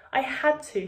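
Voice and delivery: put-on high, pleading young boy; upset boy. A narrator's reading. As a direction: plead like a distressed child.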